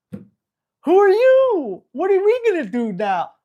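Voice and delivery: high, slow, loud worried puppy; drawn-out fretful pup calling out; anxious puppy voice. Retold or imitated. Trainer voicing a puppy left with a stranger, high and loud. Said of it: "And then I leave".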